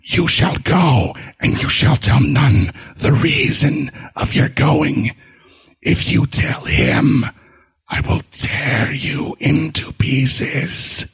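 slow, far-off ghost voice hissing threats; a dead wife's menace, slow and whispered; ghostly threat. A narrator's reading.

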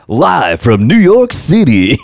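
put-on a botched New York accent, slow and loud; mock announcer accent from New York; mystery accent. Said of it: "I don't know what accent that was".

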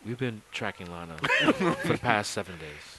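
hushed host whisper; mock Cheaters host whispering the evidence; Joey Greco whisper. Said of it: "I love how he always wants to say".

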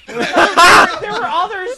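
laughing. Real, not acted. Laughing after trailer jokes.

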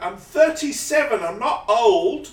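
hammed-up shouty character quote, slowing on the age; SNL sketch character. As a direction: boom it out like a washed-up life coach. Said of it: "next time someone asks me".

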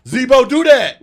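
high, loud crowd call; fan shout. Retold or imitated. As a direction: cheer it from the stands. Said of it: "favorite shout out from the crowd".

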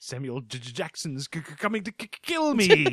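mock stammer, rising high at the end; lightly hammed stutter, climbing up; King's Speech stutter. Joking about the film, a mock stammer. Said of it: "I thought more the King's Speech".

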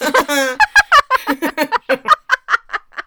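high, loud burst of laughter; cracking up hard; big laugh. Real, not acted. Laughing at the cruise joke, big real laugh. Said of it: "So dumb".

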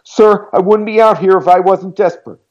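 clogged tearful voice. A narrator's reading. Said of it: "Her voice was clogged with snot".

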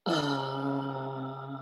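very deep, soft toning hum on uh; low open-throat uh drone from the belly. Shown as a demonstration. Guest demonstrates the deep root tone, a low droning uh. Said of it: "It sounds like this".

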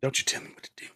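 mock defiant whisper. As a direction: hiss it under your breath.

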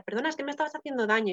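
ordinary delivery

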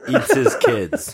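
laughing guess. Real, not acted. Offers silly answer amid laughter.

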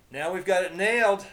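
loud, high, pleased declaration; grinning triumph. A narrator's reading. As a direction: say it with a sudden grin. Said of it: "breaking into a grin".

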